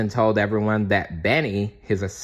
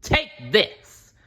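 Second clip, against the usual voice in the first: high, lightly voiced heroic cry; heroic battle cry; 10 semitones higher than usual. A narrator's reading. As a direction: fire it off like a cartoon hero. Hero fires plunger.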